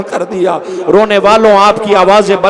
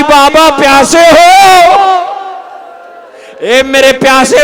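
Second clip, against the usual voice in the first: preaching at full force; 7 semitones higher than usual. Acted. Preacher voicing Sakina to her father, rising wail. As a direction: build the daughter's plea to a shout. Building to shouted lament.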